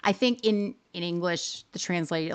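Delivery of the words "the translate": usual speaking voice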